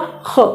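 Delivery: ख said as a throaty fricative x, not a plain kh; throat kh. Shown as a demonstration. Teacher isolates the Urdu sound for students. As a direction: breathe ख out from the back of the throat. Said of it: "इसका उच्चारण करेंगे तो ख नहीं होगा".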